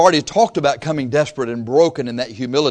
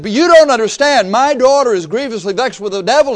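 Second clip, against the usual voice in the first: high, loud desperate plea; mother begging loudly for her daughter; pleading cry; 8 semitones higher, 6 dB louder than usual. Retold or imitated. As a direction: beg them to listen, crying out. Woman pleading with disciples.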